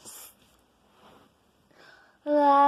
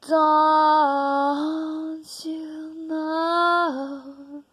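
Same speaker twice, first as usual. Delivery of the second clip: slow challenge; 4 dB louder, much slower than usual. Acted.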